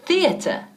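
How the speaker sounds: Theater with th sound, tongue out on first syllable; tongue between teeth for Theater. Shown as a demonstration. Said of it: "escucha cómo estoy pronunciando la palabra teatro".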